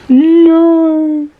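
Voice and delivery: high, loud, stretched-out no; amused disbelief. Real, not acted. Reader cracking up at a plot turn, disbelieving wail.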